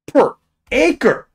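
very high, loud exclamation of disbelief; high-pitched outburst at a staggering figure; incredulous shout. Real, not acted. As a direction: shout each word in shrieking astonishment. Stunned by cicada numbers per acre.

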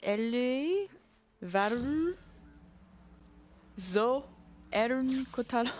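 names read with a French accent; deliberate French-accented roll call; French pronunciation. Shown as a demonstration. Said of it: "The bass gang in French".

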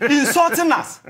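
laughing while repeating insulting; bitter laugh through the words; laughing outrage. Real, not acted. Panelist laughing at the insult.

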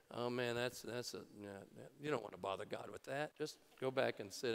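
low, quiet, brushing-off murmur; dismissive prayer partner. Pretend. Prayer partner turns someone away, low and dismissive. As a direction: wave the person away quietly.

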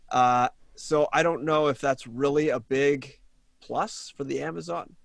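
normal talking voice

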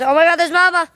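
sudden high, loud excitement; excited sighting. Real, not acted. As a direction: exclaim it as you spot someone. Spots Mama while filming.

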